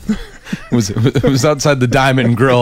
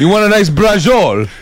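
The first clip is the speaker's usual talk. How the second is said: Italian-American deli guy; 9 semitones higher than usual. Retold or imitated. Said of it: "deli guy imitation".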